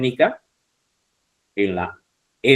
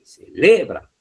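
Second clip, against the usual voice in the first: "Celebran" with LE raised and louder; stress on LE; 6 semitones higher, 4 dB louder, slower than usual. Shown as a demonstration. Teacher showing the tonic syllable to students, stress on LE in "Celebran". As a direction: punch the middle syllable LE in "Celebran". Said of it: "sílaba le".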